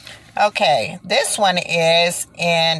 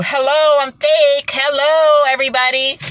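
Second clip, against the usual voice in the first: high, loud mocking call; mock shout; 9 semitones higher, 5 dB louder than usual. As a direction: shout it out like a taunting sign. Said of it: "The Avery case is screaming at you".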